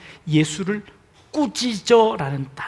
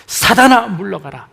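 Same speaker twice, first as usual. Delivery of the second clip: commanding shout; 9 dB louder than usual. Acted. Preacher repeating Jesus's rebuke of Satan, shouted. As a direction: bark the rebuke.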